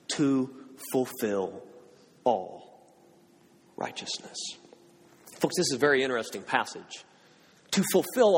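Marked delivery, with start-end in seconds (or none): 0.1-4.4 low, soft and slow, fading to breath; softly drawn out, ending in a breath; hushed emphasis. Acted. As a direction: let the phrase sink in softly. Preacher lands the key phrase.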